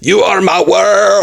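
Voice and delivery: high, slow, stretched-out Scottish roar; hammed-up Scottish fury on tender words; angry Scottish accent. Shown as a demonstration. Co-host joins the stand-up bit, angry Scottish accent. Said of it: "And the Scottish can be like".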